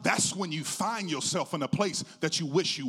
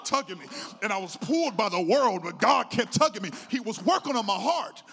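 louder, driving sermon delivery; full-force preaching; 5 semitones higher, 7 dB louder than usual. Real, not acted. Preacher telling church of God tugging him, full-force preaching. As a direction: preach it hard, building in pitch.

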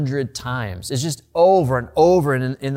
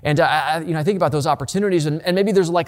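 usual speaking voice

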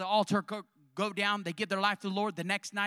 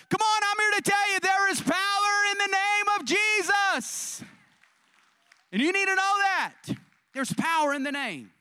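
full-force preaching; 7 semitones higher, 7 dB louder than usual. Acted. Preacher to parents and kids, loud high preaching. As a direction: shout the power of the name.

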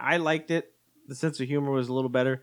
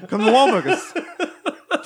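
slow, higher, louder "Wahlburgers" pitch; 11 semitones higher, 7 dB louder, much slower than usual. Pretend. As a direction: stretch it like a cheesy commercial. Echoes slogan, Wahlberg voice.

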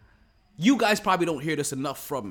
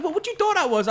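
high, loud imitation of her retort; mock indignant; 14 semitones higher, 6 dB louder than usual. Cousin's wife challenging host, mimicked with mock indignation. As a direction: throw it back as an incredulous question.

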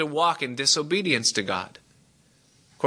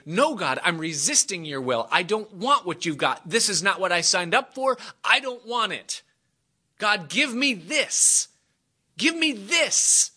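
rising, high-pitched protest; acted petulant refusal; defiant pleading; 7 semitones higher than usual. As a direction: demand it like a child, climbing higher. Acts out people resisting God's will.

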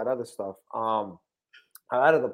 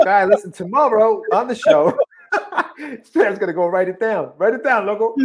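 hyped show host; 7 semitones higher, 6 dB louder than usual. Retold or imitated. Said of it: "I'm like".

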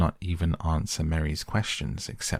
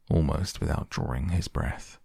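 ordinary delivery, nothing marked

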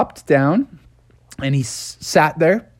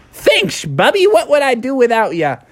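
grateful groundhog; 8 semitones higher than usual. Acted. Phil thanks Bubby.

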